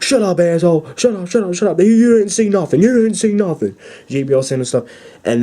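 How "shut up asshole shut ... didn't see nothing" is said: high, brash heel commentator telling his partner off; JBL heel announcer. Retold or imitated. Heel announcer shouting down his partner, brash and high. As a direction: snap it out like a smug heel announcer. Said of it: "He's like".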